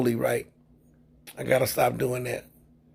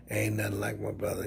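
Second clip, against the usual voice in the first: whisper; 6 semitones lower, 9 dB quieter than usual. Real, not acted. Laughing aside about his brother.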